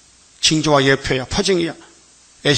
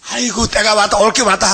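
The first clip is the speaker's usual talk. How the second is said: 흉내 내는 탄성; 6 semitones higher than usual. Retold or imitated. 사람들의 반응을 흉내냄.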